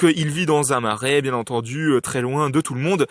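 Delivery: usual speaking voice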